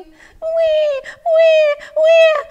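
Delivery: very high, loud mock baby wails; babies crying. Storyteller imitating the babies in the hut, wailing. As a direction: imitate wailing babies, squealing each cry. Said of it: "what were the babies doing".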